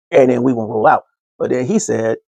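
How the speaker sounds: ordinary talk, nothing marked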